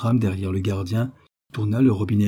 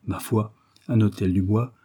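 ordinary delivery, nothing marked

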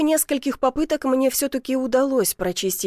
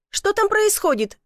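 raised pitch, worried question; 10 semitones higher than usual. A narrator's reading. Woman checking on her man at the chimney, worried. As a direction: call up in mild alarm. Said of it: "забеспокоилась Василиса".

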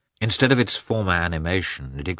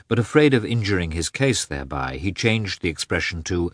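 ordinary delivery

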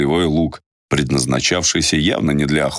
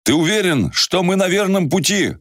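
put-on young man voice. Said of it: "спросил Ярик".

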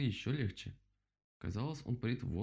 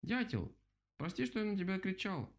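calling out; 9 semitones higher than usual. A narrator's reading. Rabbit calling to the woodpecker to apologise, mild high yell. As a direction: call up high to someone away, mild yell. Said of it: "заорал он".

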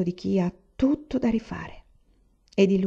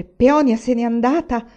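loud, higher cry of disbelief; incredulous cry; 4 semitones higher, 7 dB louder than usual. A narrator's reading. Son recalls his father's cry, loud and incredulous. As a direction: exclaim it in disbelief. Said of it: "l'incredulo grido di suo padre".

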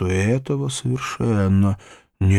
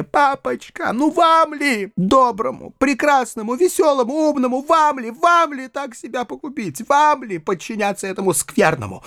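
put-on high-pitched, impassioned girl's appeal; imploring young daughter, overwrought; tearful pleading; 11 semitones higher than usual. A narrator's reading. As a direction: implore father in a high child's voice. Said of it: "заливаясь слезами".

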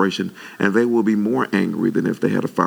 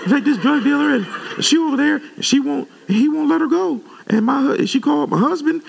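much higher put-on woman's voice, half-laughing; high imitation of the church lady, laughing through it; 13 semitones higher than usual. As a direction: keep her high, fretful voice to the end of her story. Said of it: "I might as well finish it".

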